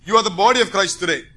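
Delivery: usual speaking voice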